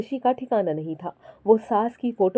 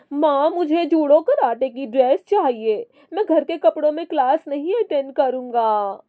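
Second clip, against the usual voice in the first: put-on higher, insistent little boy; child voice; 6 semitones higher than usual. Young son demanding a karate dress from mother, childish. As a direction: whine for the karate uniform.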